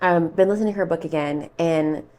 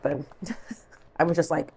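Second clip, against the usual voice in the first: normal talking voice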